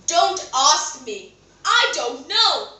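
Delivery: loud, slow, firm denial; emphatic refusal. Acted. King rebuffs the queen's question, emphatic. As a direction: push each phrase out firmly.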